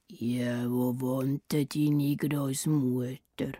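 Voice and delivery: deep, slow wolf growl. Acted. The wolf growling at Rotkäppli about her grandmother. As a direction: growl the question low and slow. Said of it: "knurrt jetzt der Wolf".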